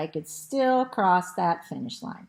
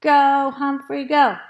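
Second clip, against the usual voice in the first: loud, higher crowd chant; cheering call; 5 semitones higher, 10 dB louder than usual. A narrator's reading. Students rooting for Humphrey, called out loud. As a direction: call out to urge the racer on. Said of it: "the students called".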